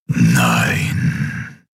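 low, drawn-out dark emperor voice; one long, low word of command from a shadowy tyrant; slow, deep creature voice. Acted. Shadow Emperor answers his servant.

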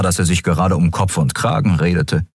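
ordinary delivery, nothing marked

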